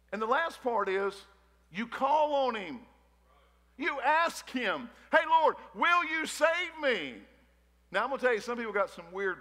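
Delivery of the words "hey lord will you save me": higher, slow, drawn-out plea to God; pleading prayer. Acted. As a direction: call on the Lord, stretching the words out.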